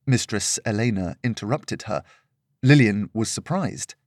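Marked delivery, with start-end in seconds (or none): none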